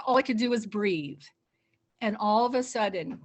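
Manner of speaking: ordinary talk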